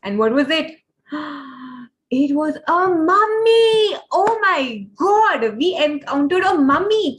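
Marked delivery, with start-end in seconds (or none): 1.1-1.8 soft, sudden intake of breath; shocked gasp. Acted. Storyteller builds to the mummy reveal. 2.1-7.2 high, drawn-out storyteller's amazement; astonished reveal. Acted. Dramatic reveal of the mummy.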